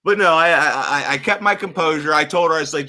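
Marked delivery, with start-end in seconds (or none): none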